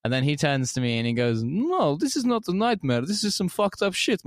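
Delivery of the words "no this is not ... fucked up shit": high mock Russian guard voice; imitating a guard in a much higher voice; security guard voice.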